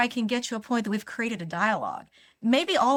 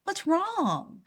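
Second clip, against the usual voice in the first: concerned asking; 9 semitones higher than usual. Shown as a demonstration. Asking an angry stranger what's up, high gentle concern. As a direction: gently invite him to explain. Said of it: "Now I'm gonna ask him".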